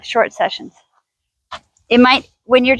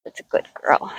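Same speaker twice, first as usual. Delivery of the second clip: soft praise; 7 dB quieter than usual. Real, not acted. Trainer rewarding mare close up, whispered tender praise. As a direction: reward her quietly. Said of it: "rewarding the horse".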